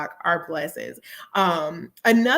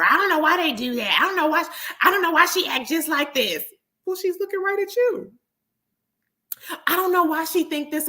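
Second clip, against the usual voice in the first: mocking clueless parent; 5 semitones higher than usual. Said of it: "even the crappiest parents".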